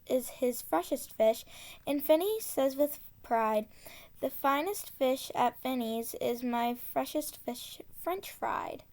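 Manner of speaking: ordinary delivery, nothing marked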